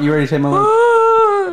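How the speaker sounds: high, loud teasing come-on; sultry delivery voice. Pretend. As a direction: play it up like a seductive delivery. Repeats the mock come-on.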